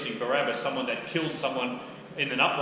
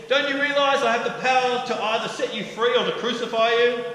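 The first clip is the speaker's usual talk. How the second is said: higher, loud, domineering; threatening challenge; 10 semitones higher, 8 dB louder than usual. Acted. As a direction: remind him who holds the power. Pilate threatens Jesus.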